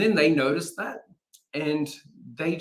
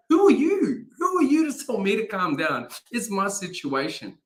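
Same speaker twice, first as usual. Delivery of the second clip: indignant; 7 semitones higher than usual. Retold or imitated. Voicing indignant inner reaction.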